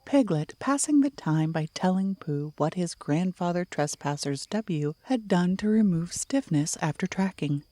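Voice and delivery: usual speaking voice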